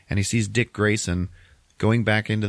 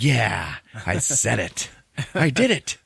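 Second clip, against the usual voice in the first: mock-triumphant, climbing higher; proud triumph; 5 semitones higher, slower than usual. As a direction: build to a high boast. Batman proud of managing apology.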